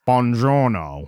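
slow hammy Southern-tinged Italian; drawn-out Buongiorno, Brad Pitt style; bad Italian accent. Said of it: "his accent was Brad Pitt from Inglourious Basterds".

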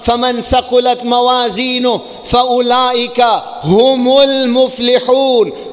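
fast chanted Arabic verse; quick Quranic recitation. Acted.

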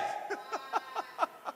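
high, light burst of laughter; quick laugh after shouting; real laughter. Real, not acted. Laughs after his blunt exhortation.

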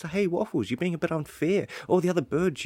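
high-pitched mock protest; imagined listener's objection.

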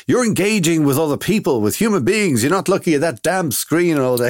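lightly voiced nagging dad; dad's voice. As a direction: scold like a fed-up father. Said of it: "all I hear is my dad's voice".

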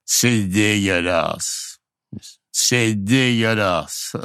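put-on drawn-out, slow Pakistani-accented French. Storyteller mimics a Pakistani waiter's newly learned French, in his accent. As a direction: stretch each syllable in an imitated Pakistani accent. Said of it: "je le dis avec l'accent".

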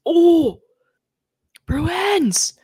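high, loud mock Bruins fan cheer; excited fan. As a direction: squeal it like an overexcited fan. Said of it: "Everybody on Twitter really likes".

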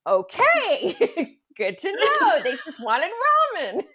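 high, loud, laughing "okay then"; amused acceptance. Retold or imitated. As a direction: laugh through a surprised concession. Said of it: "And I laugh".

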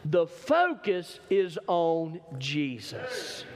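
very slow, drawn-out statement; slow emphasis. Acted. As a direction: land it slowly for weight. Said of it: "watch this".

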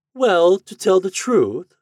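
high, slow, drawn-out lady's voice; loud ladylike preamble to a rejection; haughty lady. A narrator's reading. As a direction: begin loftily, stretching the words high. Haughty lady rejects suitor on phone.

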